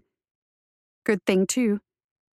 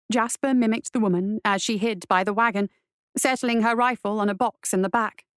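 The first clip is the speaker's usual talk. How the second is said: ordinary delivery, nothing marked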